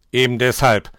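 loud, curt retort. A narrator's reading. Nikolaus shuts down Dominik's complaint, curt. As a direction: cut the discussion short, forcefully. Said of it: "war der Nikolaus kurz angebunden".

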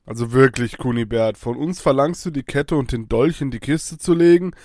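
usual speaking voice

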